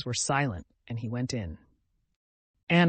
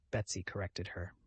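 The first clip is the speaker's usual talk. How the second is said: ordinary delivery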